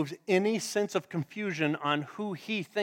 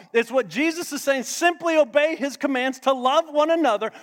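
louder, rising sermon cadence; full-force preaching; 8 semitones higher, 7 dB louder than usual. Acted. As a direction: build it like a preacher at the peak.

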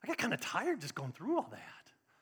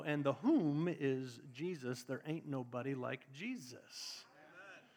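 dropped, slow emphasis; 6 semitones lower, 6 dB quieter, slower than usual. Real, not acted. Preacher drops voice for emphasis.

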